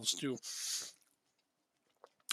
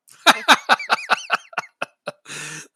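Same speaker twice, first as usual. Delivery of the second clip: big burst of laughter; loud laugh; 7 semitones higher, 13 dB louder than usual. Real, not acted. Laughs at offside call.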